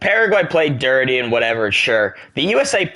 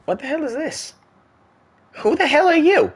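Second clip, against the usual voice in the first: startled shriek. Real, not acted. Startled by something in the game.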